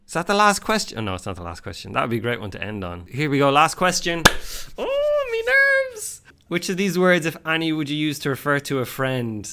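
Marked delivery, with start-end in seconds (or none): 4.8-6.2 very high comic falsetto; hammed-up nerves. Quiz taker facing the last question, hammed-up nervous falsetto. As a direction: wail it in a mock-nervous squeal.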